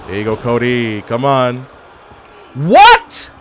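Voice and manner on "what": much higher and louder, rising; shocked what. Real, not acted. Gamer shocked by a move in the match, high yelp.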